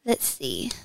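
whispered thinking aloud; whisper to self. Real, not acted. Guest pondering her favorite movie, whispering. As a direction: murmur it to yourself in a whisper.

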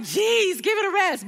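high-pitched playful groan at lovebirds; retold fond exasperation; mock exasperation. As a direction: tease them as if fed up. Mock exasperation at lovey couple.

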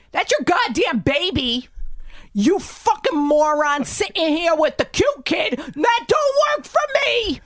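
loud, high tirade building to a scream; angry yelling. Retold or imitated. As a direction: berate her at full volume. Retold yelling at the mother.

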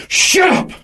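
high, strained mock yell; abusive yell. Unseen man in the dark silencing the speaker, harsh high yell. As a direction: screech it as an order to silence.

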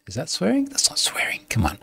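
hushed, breathy aside to himself; whispered aside. Real, not acted. Host whispering an aside while reading ChatGPT's reply. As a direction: drop to a whisper to question it.

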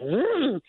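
high-pitched vroom; imitated car engine. Grandmother imitates the son-in-law's car racing up the driveway, zooming engine noise. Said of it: "came zooming up and he went".

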